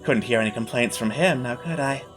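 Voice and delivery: smug, patronising villain. Acted. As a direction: purr it out like a gloating host. GM villain voice continues.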